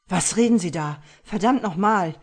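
loud, cracking panicked shout; hysterical. Acted. Husband confronting the killer, hysterical shout. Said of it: "Stimme überschlug sich hysterisch".

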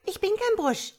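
very high, loud cheeky little voice; young tadpole. A narrator's reading. Tadpole corrects its scolding mother, high childlike voice. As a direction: pipe up in contradiction like a tiny creature. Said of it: "sagte das Junge".

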